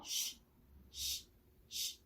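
쉬 as a soft, unvoiced shush; SH sound. Shown as a demonstration. Teacher repeats the sh sound, soft and voiceless. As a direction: let only air out on "쉬", lips pushed out. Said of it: "그런 발음입니다".

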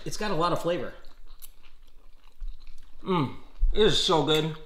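talking while chewing; chewing steak. Real, not acted. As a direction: mouth partly full of meat. Seen 0: Forked steak into mouth at -3; jaw working, hand near mouth during words.

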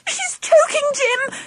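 high-pitched alarmed cry; panicked cry. A narrator's reading. Auntie Sally to Jim over choking Angela, panicked cry. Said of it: "cried Auntie Sally".

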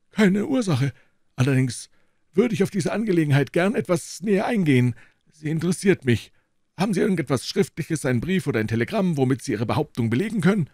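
put-on a lofty old professor receiving guests; old professor's voice. A narrator's reading. Elderly professor politely greeting visitors, high and measured. Professor presses Holmes for proof.

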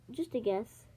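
usual speaking voice

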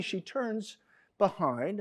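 ordinary talk

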